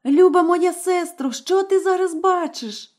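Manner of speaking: high, calling anxiously up the tower; frightened wife begging for news; anxious young wife. Acted. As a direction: call out to her sister, desperate. Wife calls to sister.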